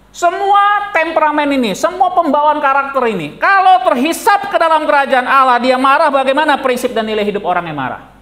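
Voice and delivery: full-force preaching. Acted. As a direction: drive the point home to the congregation. (khotbah berapi-api dengan suara lantang)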